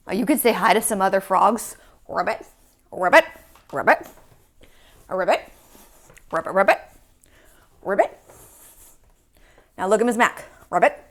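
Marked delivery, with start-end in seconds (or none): 2.1-8.2 playful frog ribbits, rising at the end; frog croak. Pretend. Saying hi as a frog. 10.7-11.0 quick frog ribbit, rising at the end; one short pretend croak; low, playful frog call. Playing a frog.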